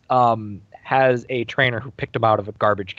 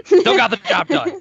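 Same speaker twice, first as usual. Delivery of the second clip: high squeaky voice; 13 semitones higher, 4 dB louder than usual. Retold or imitated.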